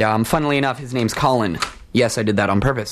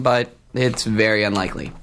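ordinary talk

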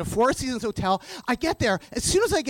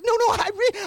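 protesting; 10 semitones higher than usual. Retold or imitated. Arguing with door staff.

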